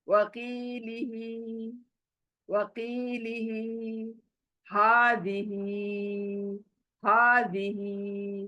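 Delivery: words said slowly with the long vowels and final ही held. Shown as a demonstration. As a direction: stretch the marked vowels and the last ही. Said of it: "ही खींच रहे हूँ खड़ी जेर". (लंबी मात्राओं को खींचकर, धीरे)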